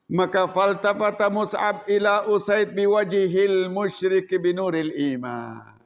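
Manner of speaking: ordinary talk, nothing marked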